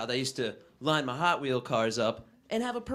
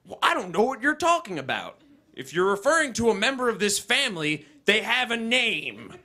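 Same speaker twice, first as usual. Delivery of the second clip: dad voice; 6 semitones higher, 4 dB louder than usual. Retold or imitated. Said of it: "I'll be like".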